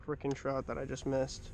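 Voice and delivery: low, soft grumble; quietly sulking about the miss; under-the-breath frustration; muttered. Real, not acted. Muttering after losing the fish.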